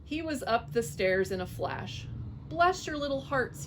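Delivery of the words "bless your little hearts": high, grateful cry; mock-tearful thanks. A narrator's reading. Fox on his knees crying to the mice. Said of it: "he cried".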